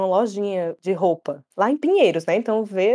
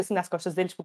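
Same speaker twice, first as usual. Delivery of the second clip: ordinary talk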